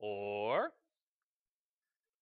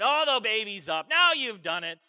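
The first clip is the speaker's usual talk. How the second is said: loud, raised scolding; fed-up, louder outburst; exasperated parent; 4 semitones higher, 9 dB louder than usual. Acted. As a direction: blame the friend for waking the baby. Exasperated parent, baby woken.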